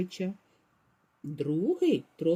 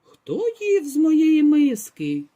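slow, louder mama bear voice; a hint of a gruff mother bear; mama bear, less loud; 7 semitones higher, 8 dB louder, slower than usual. A narrator's reading. Mama bear asks about her bowl, slow and louder. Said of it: "заревіла не так голосно".

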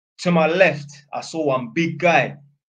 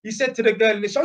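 ordinary delivery

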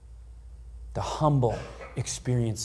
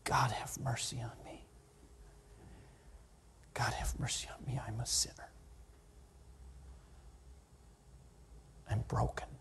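soft unvoiced whisper of mercy; broken whisper begging God for mercy; whispered prayer; 12 dB quieter than usual. Shown as a demonstration. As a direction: breathe the plea in a whisper. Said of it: "What did Jesus sound like saying those words?".

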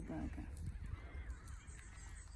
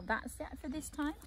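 ordinary delivery, nothing marked

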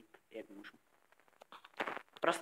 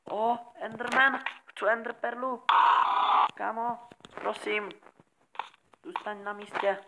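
coaxing the Enderman; 6 semitones higher, 7 dB louder than usual. Pretend. Sweet-talking an Enderman to stay.

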